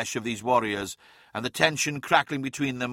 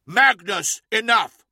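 much higher, louder sharp bark; barked command; 8 semitones higher, 8 dB louder, slower than usual. A narrator's reading. Lorgar stopping Magnus mid-argument, barked command. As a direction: cut them off with a commanding bark. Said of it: "barked Lorgar".

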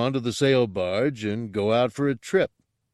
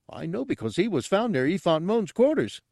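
high character voice, pleased with his theory; high-pitched dim sleuth; eager simpleton; 8 semitones higher than usual. Acted. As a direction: explain it proudly in a high voice. Gartog continues his muddled theory.

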